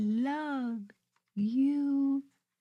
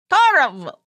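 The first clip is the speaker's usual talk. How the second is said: loud puppet wail; 3 semitones higher, 10 dB louder than usual. Acted. Puppet answering host how it felt, loud and upset. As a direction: blurt it like an upset kid.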